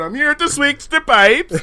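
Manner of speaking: mock a Swedish accent. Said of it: "I'm gonna do a Swedish accent".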